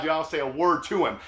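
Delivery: plain reading voice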